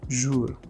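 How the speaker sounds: jour with a soft French back-of-throat r; uvular r. Shown as a demonstration. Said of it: "the R at the end".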